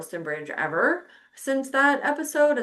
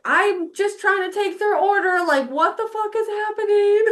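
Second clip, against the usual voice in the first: loud, high-pitched retold bafflement; incredulous outburst; 11 semitones higher, 5 dB louder than usual. Waitress recalling a message while taking an order, incredulous. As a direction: protest in disbelief, breaking into a laugh after. Retells shock while waiting on table.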